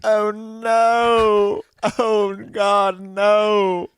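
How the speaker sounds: high, loud, drawn-out mock wail; mock crying mom. Friend imitates the mom reacting to the rollerblade bit, mock crying. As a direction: wail it like a horrified mother, hammed-up. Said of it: "Your mom crying".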